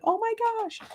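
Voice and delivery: high squeaky imagined shock; playful high-pitched oh my gosh; mock gasp of amazement. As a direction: squeal it like a viewer stunned by the mess. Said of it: "you'd be like".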